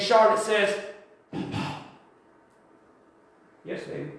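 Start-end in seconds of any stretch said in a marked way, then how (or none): 1.3-1.8 faint whispered Papa. Retold or imitated. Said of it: "She whispered that". 3.6-4.1 much softer, lower, gentle answer; tender reply. Retold or imitated.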